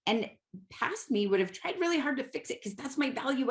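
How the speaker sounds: normal talking voice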